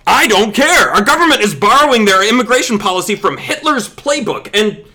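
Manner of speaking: indignant, dropped-voice protest; angry protest. Acted. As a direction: argue back hotly. Argues back against silly voices.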